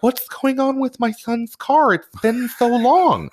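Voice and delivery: very high-pitched caricature of a complaining mother; mock customer mom. Mom asking about her son's car, mock high-pitched complaint. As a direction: squeak it out, stretching the end. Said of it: "here's mom and son".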